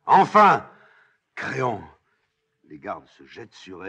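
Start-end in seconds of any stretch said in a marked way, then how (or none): 0.1-1.8 high, loud cry easing on the name; great relieved cry. Acted. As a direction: cry out in release at last. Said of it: "dans un grand cri soulagé". (grand cri soulagé)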